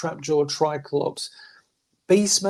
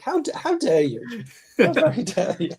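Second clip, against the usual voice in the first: mock outrage; 4 semitones higher than usual. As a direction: act scandalised, pitching it up theatrically. Mock offence at retold praise.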